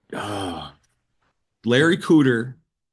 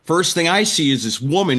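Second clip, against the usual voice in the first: normal talking voice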